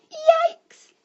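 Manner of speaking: very high, loud yelp of alarm; startled shout. A narrator's reading. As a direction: yelp as if suddenly frightened. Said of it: "shouted Sally".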